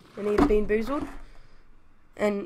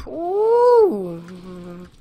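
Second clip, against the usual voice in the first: disgusted ooh; 7 dB louder than usual. Real, not acted. Reacting to gross taste.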